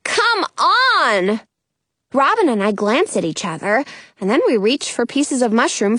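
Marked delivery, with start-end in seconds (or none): 0.1-1.4 high, eager call; urging shout. A narrator's reading. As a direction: urge friends to follow. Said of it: "giant-necked Penny shouts".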